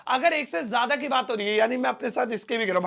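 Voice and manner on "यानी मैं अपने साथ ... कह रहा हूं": normal talking voice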